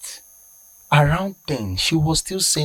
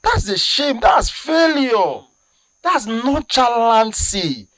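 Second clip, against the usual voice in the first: indignant outrage; 7 semitones higher than usual. Real, not acted. Indignant at hospital's single antivenom.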